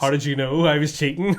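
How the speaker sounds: laughing, loud, in character; laughing mock accusation. To the poker mate, laughing and loud. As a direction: blurt it out through a laugh. Acting Boise's line, poker scene.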